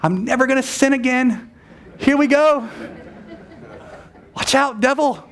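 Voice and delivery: hammed-up overconfidence, charging out the door; mock triumphant churchgoer. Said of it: "you think".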